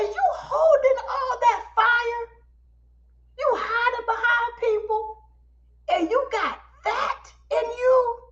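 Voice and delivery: high, drawn-out amazed questioning; slow, high-pitched mock disbelief; incredulous. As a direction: imitate a host's astonished telling-off. Amazed host scolding, retold.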